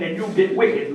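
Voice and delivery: ordinary talk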